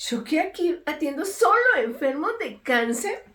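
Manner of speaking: excited doctor. Retold or imitated. Retelling the doctor's excited reaction.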